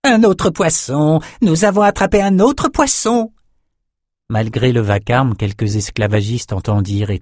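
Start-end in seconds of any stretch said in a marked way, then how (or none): 0.0-3.3 much higher, excited shaman crowing; gleeful shrill alarm. A narrator's reading. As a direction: crow it out like a crazed imp. Albino jumps, shouting alarm.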